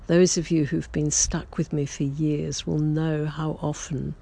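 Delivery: usual speaking voice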